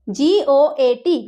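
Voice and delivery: জিওএটি spelled out letter by letter, very slowly; slow spelling. Shown as a demonstration. Teacher to students spelling জিওএটি, very slow. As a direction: dictate জিওএটি slowly to the class. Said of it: "কী হবে?".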